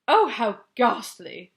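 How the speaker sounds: loud, slow horrified cry. Acted. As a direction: cry out in horror, drawn out. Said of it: "the famous voice cried out".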